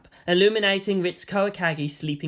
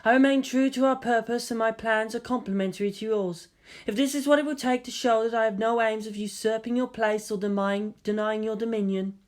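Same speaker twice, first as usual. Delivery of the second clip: solemn pledge; 4 semitones higher than usual. Acted. Raka pledging herself formally.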